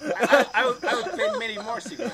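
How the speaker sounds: laughing. Real, not acted. Laughing at card.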